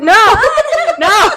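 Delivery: loud, high-pitched cry of protest; mock-horrified shout. Host protesting a co-host's identical notes, mock-horrified shout. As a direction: shout it out in playful dismay.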